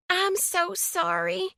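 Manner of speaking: high, slow, effusive sorry; gushing apology. A narrator's reading. Twix lady gushing her apology to the brunette. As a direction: pour out the apology, overdone. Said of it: "Twix lady gushed".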